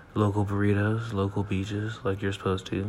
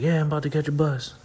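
casual friend voice; 7 semitones higher, 4 dB louder, faster than usual. Retold or imitated. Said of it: "he's like".

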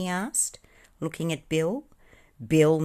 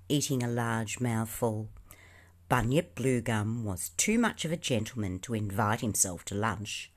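plain reading voice

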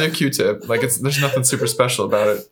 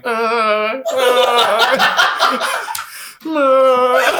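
high, hammed-up fake moaning grunt; mock moan; 11 semitones higher, much slower than usual. Shown as a demonstration. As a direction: groan it out like a cow in labour. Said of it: "You know how you moan".

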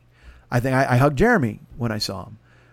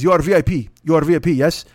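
1 mock Russian accent; 5 dB louder than usual. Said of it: "I don't do accents".

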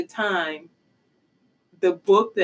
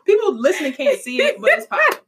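high laughing hype; excited laughing; 8 semitones higher, 5 dB louder than usual. Real, not acted. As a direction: cheer it with a laugh. Hyping friend's hair, laughing.

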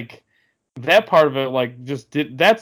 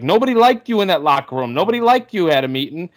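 mock rant, higher and louder; Ozzie Guillen rant; 6 semitones higher, 4 dB louder than usual. Retelling the manager's jab at a player, loud mock rant. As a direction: belt it out like a hothead manager. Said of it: "Ozzie Guillen was like".

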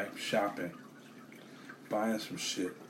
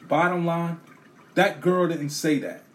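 raised, louder and emphatic; firm bottom line; 6 semitones higher, 5 dB louder than usual. Retold or imitated. A man insists to the supervisor that the cashier is innocent, firm and loud. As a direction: lay it down hard and final.

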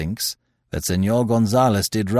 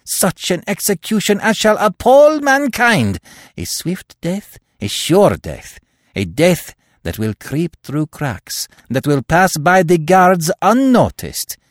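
building from firm to a higher, louder, fervent pitch; carried away; 6 semitones higher, 4 dB louder than usual. A narrator's reading. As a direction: let the zeal run away with him. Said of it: "He stopped dead with flushed cheeks and kindling eyes".

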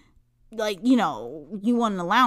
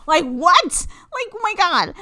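higher and louder, a squeaky what; shocked disbelief; 9 semitones higher, 4 dB louder, slower than usual. Retold or imitated. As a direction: retell the shock as if it hits again. Shocked at the allowance offer.

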